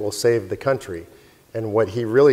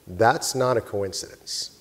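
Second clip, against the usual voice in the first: slow, grave and quiet; slow and solemn; 3 semitones lower, 8 dB quieter, slower than usual. Retold or imitated. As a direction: let each word land with awe. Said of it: "I'll never forget these words".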